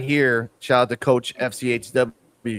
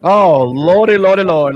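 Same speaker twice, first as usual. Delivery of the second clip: excited exclamation; 2 semitones higher, 7 dB louder than usual. Acted. Hype opener exclamation.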